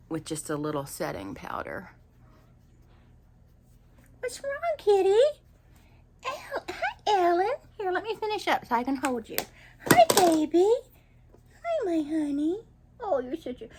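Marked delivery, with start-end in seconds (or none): 4.2-13.7 talking to a cat. Real, not acted. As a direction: coo at the kitty lovingly. Talking to her cat Alan.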